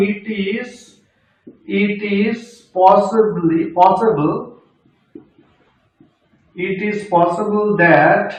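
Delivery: very slow, each word apart; slow dictation. Shown as a demonstration. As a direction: dictate it slowly to students. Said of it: "আমি ভেঙে ভেঙে বলছি প্রত্যেকটাকে". (ধীরে ধীরে বলা)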